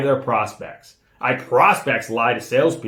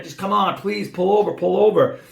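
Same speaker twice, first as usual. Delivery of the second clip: high-pitched retold pleading; retold desperate plea; 7 semitones higher than usual. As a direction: plead with him to pull over, voice raised in pitch. Chasing boss, honking, begging him to stop.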